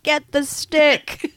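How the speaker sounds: slow, drawn-out mock Austin voice; Austin Powers quote. Quoting Austin Powers to a friend, slow and laughing. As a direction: stretch it out, laughing through it. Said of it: "Austin Powers is like".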